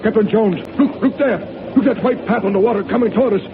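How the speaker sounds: high, urgent warning; alarmed. Acted. First mate warning the captain of a torpedo, high alarmed cry. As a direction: point and warn the captain in alarm.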